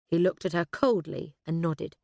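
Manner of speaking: ordinary delivery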